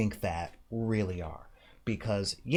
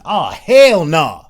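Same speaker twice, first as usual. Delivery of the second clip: slow, much louder sassy mock retort; 5 semitones higher, 18 dB louder, much slower than usual. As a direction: blast the cliché line to mock it.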